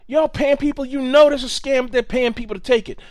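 high, loud, worked-up imitation; mock the ranting guy, pitch up; agitated man. Retelling the metro station man, mock agitated shout. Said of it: "he said".

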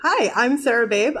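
ordinary talk, nothing marked

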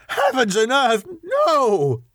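high-pitched outraged exclamation; passionate cry. A narrator's reading. Arthur refusing to let Lucy be mutilated, passionate cry. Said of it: "cried Arthur in a storm of passion".

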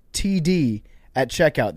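brisk sponsor read to listeners. Acted. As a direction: sell the promo clearly. Host reads sponsor ad.

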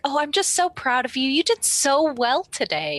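high-pitched, gushing, patronizing praise; doting praise. Acted. Isabel fussing over Bazig to unsettle him, doting. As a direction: gush like an overproud mother. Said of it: "trying to make him a little uncomfortable".

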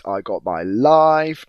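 loud, slow, ecstatic; pure delight; thrilled. Real, not acted.

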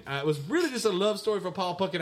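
ordinary talk, nothing marked

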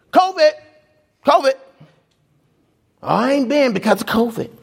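high, lazy mock voice; mocking excuse. Pastor imitating people skipping church, mocking. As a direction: toss out the excuse with a smirk.

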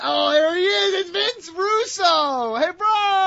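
high, loud bro voice; hyped bro voice. Acted. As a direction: greet like a pumped-up bro. Playing Vince Russo on phone.

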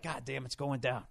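quiet aside to a friend; out of the side of the mouth. Retold or imitated. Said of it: "Side of my mouth".

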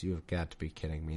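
quick, soft, fed-up grumble under the breath; exasperated mutter. Real, not acted. Reader dreads a chapter of names, exasperated. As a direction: groan about a boring chapter. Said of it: "groaning at the list of names".

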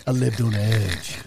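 low, slow, laughing tough talk; mock swagger. Friend mocking him as living dangerously, low swagger. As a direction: brag like a wild man, tongue in cheek. S0 mocks S1's night.

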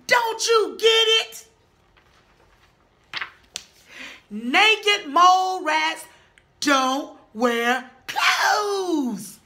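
loud, exasperated scolding; exasperated shout. Acted. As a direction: shout it, fed up and slow. Mole rats scolding Wilbur.

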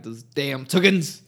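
loud, slow, drawn-out cartoon accent; exaggerated cartoon intonation. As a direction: bellow it like a stage villain. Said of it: "Why are you talking like that".

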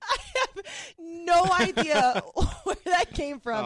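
high, slow, laughing almost to tears; can't get the words out for laughing; helpless laughter. Real, not acted. Guest laughing at host, high and slow with tears. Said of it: "now I can't stop laughing".

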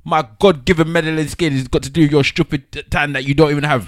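hammed-up indignant rant, loud and high; mock outrage.

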